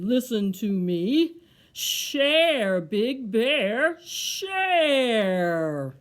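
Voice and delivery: loud, slow booming tree; deep tree voice. Acted. The tree orders Big Bear again, deep and loud. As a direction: draw out the command loudly, like a big tree. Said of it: "A deep, low voice said".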